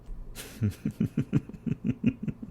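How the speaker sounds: breathy amused villain chuckle; airy laugh. Acted. Villain laughs softly at the party's feeble offer.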